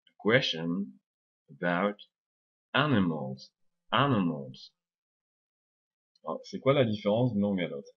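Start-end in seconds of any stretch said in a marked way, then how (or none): 0.1-4.7 broken-up demo, QUES in "question" and AN in "animals" stressed; "question", "about", "animals" separated, weak syllables reduced; English tonic stress. Shown as a demonstration. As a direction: chunk it with pauses, first syllables of "question" and "animals" punched. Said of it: "on accentue certaines syllabes".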